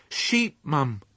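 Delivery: high little-pig voice; small piglet. Acted. Piglet telling mum his dream, high little voice. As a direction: confess it as a young piglet.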